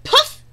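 very high, loud puff sound. A narrator's reading. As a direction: burst out with a squeaky puff. Pufferfish inflates, sound effect.

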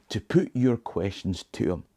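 normal talking voice

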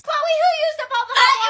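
high-pitched acted mom demand; mom calling out. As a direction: play an annoyed mother calling to her child. Mother calls out to Chloe.

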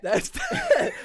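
high, breaking into laughter; laughing repeat. Real, not acted. Repeating the punchline, laughing. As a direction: laugh through the words. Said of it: "laugh on line".

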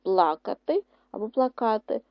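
ПЛАкати, then плаКАти; first плакати stressed on ПЛА, second плакати on КА; contrasting word stress. Shown as a demonstration. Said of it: "Поставити наголос у вставлених словах".

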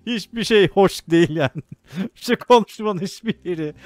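laughing loudly while talking; words spilling out through real laughter. Real, not acted. (kahkahalar arasında, yüksek sesle)

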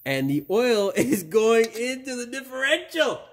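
high, delighted showing-off voice; gleeful marvelling. Acted. Showing viewers oil going into the differential, high gleeful wonder.

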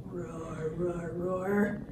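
soft, low dinosaur rumble; quiet roaring. Acted. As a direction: roar low under your breath. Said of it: "gonna be lots of roaring".

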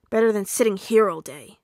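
loud, grudging grumble; grumbling. A narrator's reading. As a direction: grumble it loudly. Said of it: "Bakugo grumbled".

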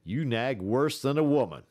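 annoyed and done with his nagging; irritated retort. A narrator's reading. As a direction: Scott scolding Matt from bed, irritated. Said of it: "stirred irritably".

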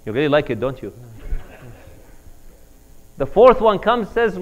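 ordinary delivery

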